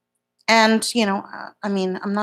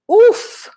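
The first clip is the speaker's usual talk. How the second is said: high, loud "oof"; sudden high yelp of dismay; 8 dB louder than usual. Real, not acted. Reacting to a bad camera angle.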